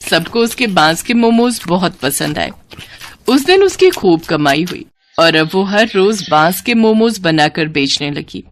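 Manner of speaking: ordinary delivery, nothing marked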